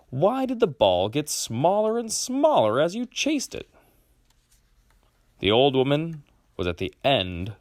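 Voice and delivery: ordinary delivery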